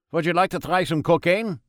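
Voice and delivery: put-on high, loud German-accented doctor; a jolly German doctor offering cocaine; Freud in German accent. A narrator's reading. Freud offers God cocaine, German-accented and high.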